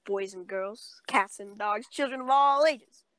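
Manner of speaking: loud showman greeting to the crowd; swaggering panto hero. Pretend. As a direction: boom it out like a panto host. Kids' show style intro.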